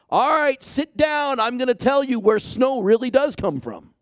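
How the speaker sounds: bossy mock lecture, up in pitch; mock lecturing God. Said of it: "expound on his theological omniscience".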